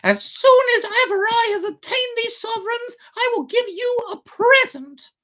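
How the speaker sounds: very high, eager little puppet voice; high puppet voice making a promise. A narrator's reading. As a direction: promise it like a greedy child. Pinocchio voiced in story.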